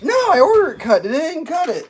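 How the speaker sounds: high, fast, loud protest; mock indignation. Woman protests her sandwich came uncut, mock indignant. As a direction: imitate her outrage, voice shooting up.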